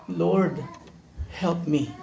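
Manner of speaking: humble whispered-ish request; soft plea. Acted. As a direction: ask quietly for help. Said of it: "inulit lang niyang kanyang sinabi".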